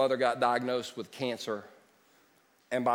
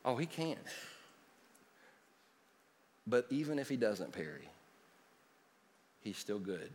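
much softer, lower, gentle; tender mother's voice; 5 semitones lower, 10 dB quieter than usual. Retold or imitated. Mother comforting her son from her hospital bed, tender. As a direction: speak it softly like a dying mother. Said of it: "she smiled, she said".

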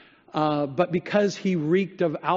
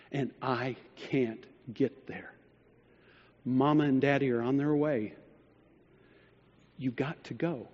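through tears; 5 semitones lower, 5 dB quieter than usual. Retold or imitated. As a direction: lower and softer, a mild retelling of a tearful plea. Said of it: "through her tears".

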